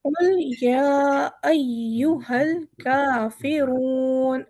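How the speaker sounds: कुल या अय्युहल काफ़िरून recited slowly, heavy रा, long रून; tajweed recitation. Shown as a demonstration. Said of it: "रा हमारा मोटा है और रून काफ़ी लंबा हो जाएगा". (रून को लंबा खींचकर पढ़ें)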